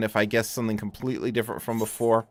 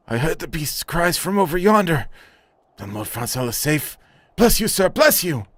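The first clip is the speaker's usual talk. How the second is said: grateful knight; 7 semitones higher than usual. Acted. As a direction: bless the hero with relief. Voices NPC dialogue.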